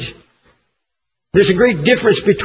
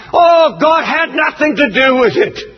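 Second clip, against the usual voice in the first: mocking TV preachers; 4 semitones higher than usual. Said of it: "hear that they've said on television".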